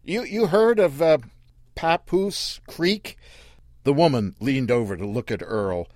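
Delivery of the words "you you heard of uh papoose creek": higher, halting and unwilling; reluctant driver. Acted. Daddy reluctantly asking Earl the way, higher and halting. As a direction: ask while hating to ask. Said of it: "Daddy said it like he didn't want to say it".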